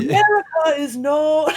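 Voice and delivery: high, loud, drawn-out proclamation; slow, hammed-up stranger voice; imitated stranger. Repeats the man's grand statement.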